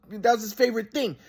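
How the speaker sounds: ordinary talk, nothing marked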